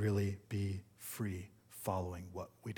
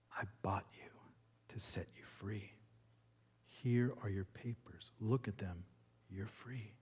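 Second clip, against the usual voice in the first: calm her softly, pausing between phrases; gentle reassurance; 2 semitones lower, 9 dB quieter than usual. A narrator's reading. Young man to the woman he bought, gently reassuring. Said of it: "gently placed them in her hand again, and said".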